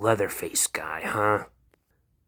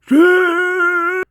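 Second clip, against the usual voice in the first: monster scream; 12 dB louder than usual. Acted. Leatherface screams.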